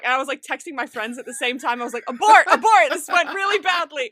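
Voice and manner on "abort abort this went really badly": loud, high comic alarm; mock panic. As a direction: call off the mission in hammed-up panic. Acting out panicked texts to friends.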